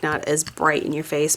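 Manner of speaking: ordinary delivery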